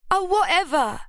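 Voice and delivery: high, spat-out sarcasm; sarcastic. Acted. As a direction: spit it back sarcastically. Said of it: "Ruby spat back sarcastically".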